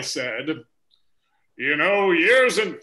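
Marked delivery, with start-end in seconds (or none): 1.6-2.8 put-on slow Western cowboy accent. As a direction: drawl it like an old cowboy. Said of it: "that's the cowboy voice I'm doing there".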